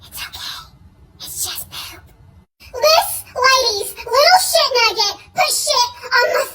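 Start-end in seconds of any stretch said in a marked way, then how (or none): 0.0-2.0 put-on soft child's whisper; mock child whispering comfort. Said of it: "she whispers".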